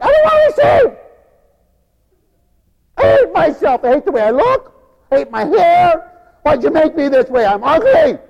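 high, loud, anguished wail; despairing sinner. Retold or imitated. Sinner refusing God's love, high anguished wail. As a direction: cry it out in self-hatred.

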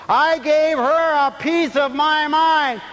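high loud slow proud boast. Retold or imitated. Preacher mocking a proud boast of telling someone off. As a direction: belt it out slowly. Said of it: "They said it exactly right".

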